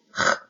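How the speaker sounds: French R rasped at the uvula, written here as "h"; uvular sound. Shown as a demonstration. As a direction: say "h" as an isolated uvular fricative. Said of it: "它是个小舌音". (用小舌摩擦发出“h”这个音)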